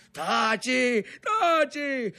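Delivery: sharp, high yell; shouted silencing. A narrator's reading. Brother to screaming boy, shouting angrily. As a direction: shout the younger one into silence. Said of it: "gli gridò il fratello col coltello fra i denti".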